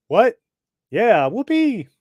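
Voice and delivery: high, loud cheering voice; excited cheer. Acted. Soldiers cheer as Billy Batson arrives, high excited voice. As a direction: whoop with delight.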